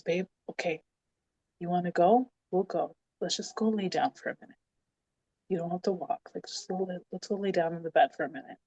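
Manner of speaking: calm her down, imitating a patient partner; gentle persuasion.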